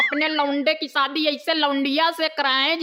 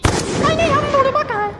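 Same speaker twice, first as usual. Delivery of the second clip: scolding wife in a much higher voice; man playing the mother, pitched far up; 12 semitones higher, 4 dB louder than usual.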